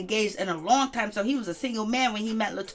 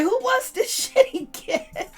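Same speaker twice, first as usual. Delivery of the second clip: mocking laugh; 13 semitones higher, slower than usual. Mocking the cast's reaction.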